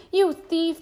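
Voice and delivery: put-on boy's angry high cry at the chimp; cried out. A narrator's reading. Boy shouting at the chimp who stole his banana, angry cry. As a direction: snap at the thieving chimp. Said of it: "Toby cried".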